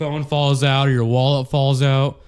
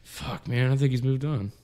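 imitated whisper; 2 semitones lower, 7 dB quieter than usual. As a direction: mimic someone confiding under their breath, still voiced. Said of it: "you were whispering to Brad".

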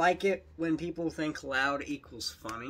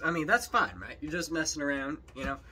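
usual speaking voice

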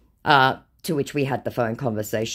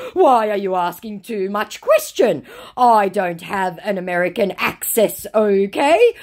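high, louder mocking scammer read; 7 semitones higher, 5 dB louder than usual. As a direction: parody the scammer's broken English. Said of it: "to which he responds with".

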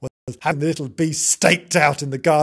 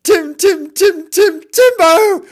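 high, loud sing-out call for a cat; 10 dB louder, slower than usual. Acted. Calling the cat in the garden, loud and high. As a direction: call the pet from across a garden. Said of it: "stand in the garden and call".

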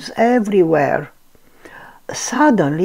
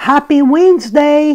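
calling out; 8 semitones higher, 5 dB louder than usual. A narrator's reading. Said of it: "called Pooh".

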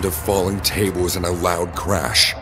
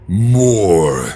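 loud, drawn-out demand; demanding glutton; 7 dB louder than usual. Acted. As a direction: stretch it out loud and insistent. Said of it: "he demanded".

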